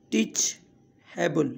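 ٽيچيبل slowly, a long pause after "teach"; broken into parts. Shown as a demonstration. Teacher repeating the word for learners, slowly in two parts. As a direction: split ٽيچيبل into "teach" and "able". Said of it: "ته ان لاءِ توهان هن جا syllable ٺاهيو".

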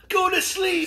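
high called-out command. Shown as a demonstration. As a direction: call it out to the crew. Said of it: "When I yell".

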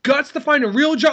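high, fast hammed-up tirade; imitating an outraged, scornful rant; mock angry confrontation. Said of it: "he grabs him by the collar".

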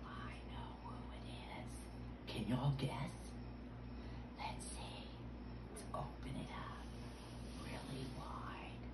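barely audible, teasing whisper; secretive whisper. Pretend. Host about to reveal Mister Turtle to children, whispering. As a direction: whisper it to build suspense.

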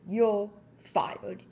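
a hint of a gruff boss firing him; lightly voiced Mister Krabs. Mister Krabs fires SpongeBob, a hint of gruff boss. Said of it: "Mister Krabs replied".

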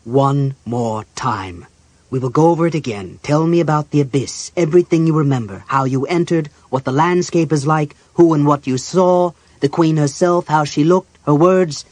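loud, insistent questioning voice; demanding interrogator. Acted. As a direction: press for every detail, loudly. Figure interrogates the patient in the story.

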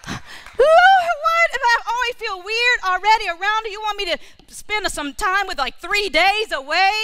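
very high, loud disbelieving cry; shocked protest. Retold or imitated. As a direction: yelp it up at the Lord in disbelief. Shocked reply to the Lord.

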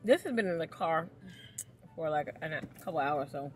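chewy candy in mouth; talking while chewing. Real, not acted. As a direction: mouth full of caramel. Seen 0: Puts caramel in mouth at -4, talks while chewing, wrapper held up.

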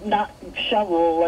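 normal talking voice

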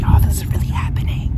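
intense, forceful stage whisper. Real, not acted. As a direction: whisper it urgently to the camera. Whispers to camera before surgery.

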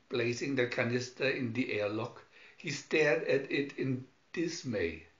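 ordinary delivery

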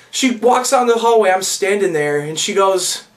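normal talking voice

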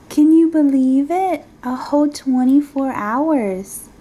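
can hardly contain the excitement; thrilled disbelief. Real, not acted. Baby about to turn one day.

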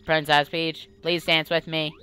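even, monotone robot request; computer voice. Acted. Computer asking the princess to dance, monotone. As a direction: ask it on one level note. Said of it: "I'll do the voice".

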